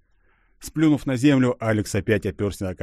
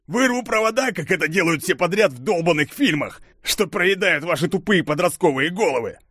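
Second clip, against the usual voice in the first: angry; 7 semitones higher than usual. Acted. Alex rants at Christina.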